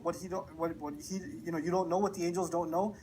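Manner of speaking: ordinary delivery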